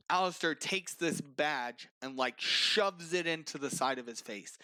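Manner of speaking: ordinary delivery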